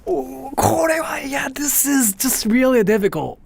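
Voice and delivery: high, drawn-out whimpering amazement; overwhelmed wail. Real, not acted. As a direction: whine as if it's too much. Overwhelmed reaction to her Japanese.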